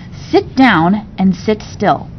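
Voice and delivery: slow, deliberate order; slow firm command. Acted. Nanny orders the girl to stay put, slow and firm. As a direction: spell out each word firmly.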